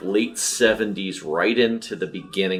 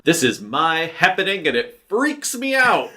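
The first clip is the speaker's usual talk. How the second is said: high, manic movie-villain line; quoting a movie villain; 9 semitones higher, 3 dB louder than usual. Retold or imitated. Quoting the Z-Man line to friends, manic and high-pitched. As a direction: spit out the famous line like a crazed killer. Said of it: "he is also known for the line".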